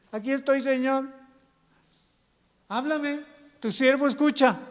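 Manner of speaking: acted servant answering God; Samuel. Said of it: "como Samuel".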